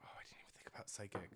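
fast, breathy whisper aside. Real, not acted. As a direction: whisper it quickly to yourself. Aside realizing plants resist psychic.

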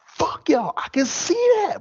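high-pitched mock fed-up outburst; Corey. Calm friend cursing out wrestling, high mock exasperation. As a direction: squeak out a friend's imagined cussing. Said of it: "Corey would just be like".

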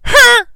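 high loud barked grunt; mock arena grunt; imitated bullhorn-style crowd grunt. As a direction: bark it like the crowd chant. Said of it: "and you hear".

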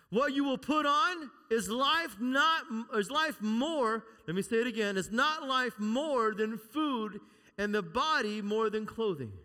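loud, high, slow preaching of the verse; forceful scripture reading. Acted. Pastor preaching Jesus' words to the church, loud slow scripture. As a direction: press every word of the verse.

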